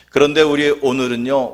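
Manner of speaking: usual speaking voice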